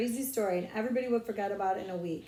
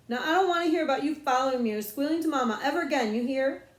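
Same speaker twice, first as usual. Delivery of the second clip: rushed threat; 5 semitones higher, 6 dB louder, faster than usual. Acted. Big brother threatens little sister.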